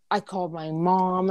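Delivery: ordinary delivery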